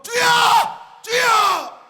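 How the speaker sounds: very high, loud shouted prayer; preacher showing an urgent prayer cry. Shown as a demonstration. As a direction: cry out to God in a hurry. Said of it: "다급할 때 어떻게 기도하죠".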